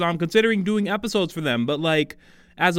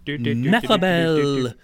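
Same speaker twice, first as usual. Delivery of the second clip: slow, drawn-out name callout; fighting game announcer; 3 dB louder, much slower than usual. Acted. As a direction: boom it like an arcade announcer. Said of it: "came straight out of a cruddy nineties fighting game announcer's mouth".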